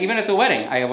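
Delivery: ordinary talk, nothing marked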